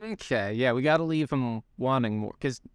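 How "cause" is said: normal talking voice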